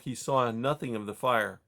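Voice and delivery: plain reading voice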